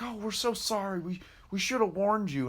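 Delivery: high-pitched imitation of apologetic old folks; apologetic old couple. Old couple apologize to the mover, imitated high voice. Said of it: "They were like".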